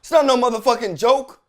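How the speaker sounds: high, loud scolding echo; emphatic backing. Acted. Host amplifying judge's rebuke, loud and emphatic. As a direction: shout it in support of the judge.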